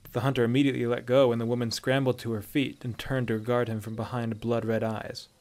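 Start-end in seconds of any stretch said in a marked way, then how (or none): none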